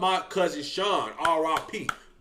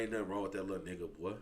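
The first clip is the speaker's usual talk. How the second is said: low, quiet and fast as Big Vinny; Big Vinny's voice; 11 semitones lower, 17 dB quieter, much faster than usual. Retold or imitated. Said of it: "Big Vinny like".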